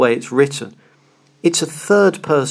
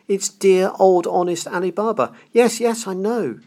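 put-on higher, excited old man lost in memory; ecstatic Scrooge; 5 semitones higher than usual. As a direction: gush like a delighted child. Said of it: "Scrooge exclaimed in ecstasy".

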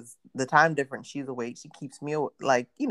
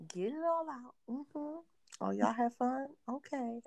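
higher, softer mock-soothing therapist voice; mocking sister; 8 semitones higher, 7 dB quieter than usual. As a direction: parody her coaxing you to vent.

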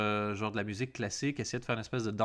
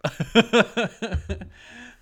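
high, loud howl of laughter; 10 semitones higher, 11 dB louder than usual. Real, not acted. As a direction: laugh till you cry. Laughs till crying.

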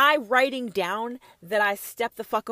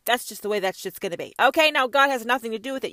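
ordinary talk

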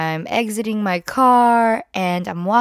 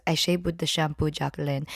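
lower, softer, quick explanation; matter-of-fact mom; 6 semitones lower, 7 dB quieter, faster than usual. Retold or imitated. Mom telling her daughter how she shaved, low and quick. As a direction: brush it off quietly.

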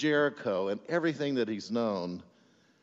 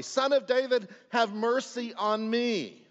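high, drawn-out plea; pleading call stretched out over a crowd; loud crying out; 9 semitones higher than usual. Acted. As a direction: cry out for mercy, pitched high and slow. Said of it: "He cried out even more loudly".

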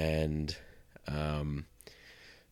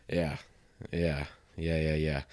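sore throat; 5 dB quieter than usual. Real, not acted. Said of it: "it hurts to swallow".

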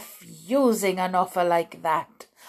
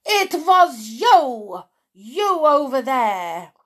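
furious accusation; 6 semitones higher, 9 dB louder than usual. A narrator's reading. Grand High Witch accusing a witch, furious loud. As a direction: point her out in fury. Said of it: "she shrieked".

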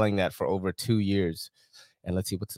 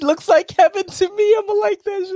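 laughing; 8 dB louder than usual. Real, not acted. Cracking up at video.

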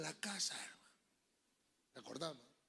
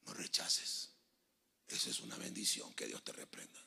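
whispered, soft and low; hushed scripture; 12 semitones lower, 7 dB quieter than usual. Acted. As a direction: lean in and whisper the verse. Baja la voz para el versículo. (susurro íntimo)

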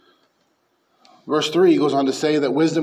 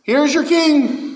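much higher, slow, louder proclamation; Pilate proclaiming; 11 semitones higher, 5 dB louder, much slower than usual. Retold or imitated. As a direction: hold up the king to the crowd. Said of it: "Pilate's off".

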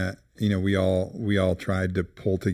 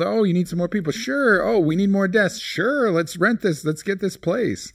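much higher, singsong breezy agreement; mock eager yes-man; 10 semitones higher than usual. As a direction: play the boss who says yes to everything. Mocking their own easy yeses.

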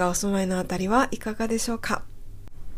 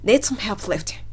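slow model line. Shown as a demonstration. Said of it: "手を貸してくださいと言っているんですね".

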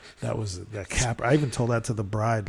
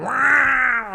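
loud, high vroom of a passing car; imitated car roar; 12 semitones higher, 11 dB louder than usual. Car driving among drunk guests, imitated roar. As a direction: roar like a car tearing past.

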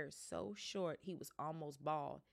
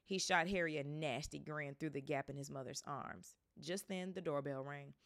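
ordinary delivery

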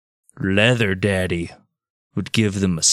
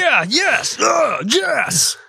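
excited yell; much slower than usual. Acted. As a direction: cheer like someone handed a prize. Voicing tribe delighted by beads.

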